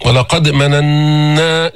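مننا with a long nasal hum on the doubled nun; ولقد مننا said slowly, the shadda on نّ held in the nose; clear ghunna. Shown as a demonstration. Teacher showing the ghunna on the doubled nun. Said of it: "جبنا غنة بينة".

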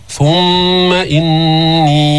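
ثم إني chanted with a very long final "ee"; slow ثم إني, vowel held on one note; lengthened madd. Shown as a demonstration. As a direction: stretch the end of إني far out. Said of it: "مدينا المنفصل".

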